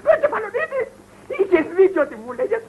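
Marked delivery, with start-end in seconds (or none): 0.1-0.8 high-pitched exclamation; raised repeat. Acted. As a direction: send Κεφαλονίτη up high in pitch. (αναφώνηση σε ψηλό τόνο)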